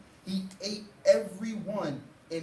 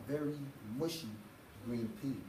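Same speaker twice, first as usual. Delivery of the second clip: soft punchline; 4 semitones lower, 9 dB quieter, faster than usual. A narrator's reading. Punchline, fading.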